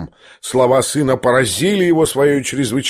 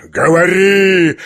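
loud, high, drawn-out command; frenzied roar; 6 semitones higher, 5 dB louder, much slower than usual. A narrator's reading. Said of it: "ревел генерал в совершенном исступлении". (исступлённый рёв)

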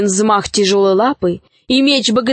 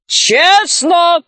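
high, defiant shout; 7 semitones higher, 4 dB louder than usual. A narrator's reading. Robbers shout back at the caravaners, high defiant yell. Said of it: "закричали разбойники".

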